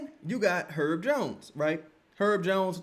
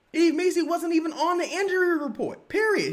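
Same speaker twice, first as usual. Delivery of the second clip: incredulous outburst; 11 semitones higher, 4 dB louder than usual. Real, not acted. Ranting to viewers about injury reports, incredulous. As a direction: pitch jumps way up, louder, disbelieving. Repeating injury news, then escalating.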